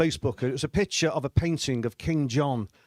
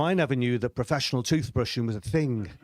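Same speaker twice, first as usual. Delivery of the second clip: ordinary talk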